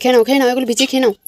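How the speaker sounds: quick, loud urging to eat up; urging fast. Real, not acted. Mother hurrying her son to soak and eat, fast and loud.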